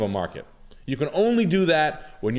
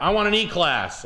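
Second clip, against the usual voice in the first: higher, louder, naive car buyer; mock customer demand; 6 semitones higher, 4 dB louder than usual. Car buyer telling the dealer what he wants, naive and eager. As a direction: play the customer who wants it all. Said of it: "they're like".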